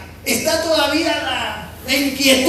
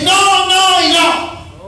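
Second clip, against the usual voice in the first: firm no; 4 semitones higher, 5 dB louder, much slower than usual. Retold or imitated. Parent refusing firmly.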